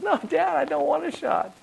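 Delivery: put-on little girl pleading. Dad imitating his daughter refusing the shot, whiny child voice. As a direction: plead like a scared five-year-old. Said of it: "her first response is".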